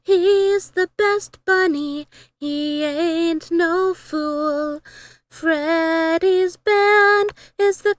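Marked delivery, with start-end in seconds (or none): none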